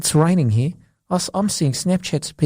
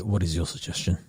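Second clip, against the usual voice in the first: boss voice; 7 semitones lower, 8 dB quieter than usual. Pretend. Boardroom boss skit.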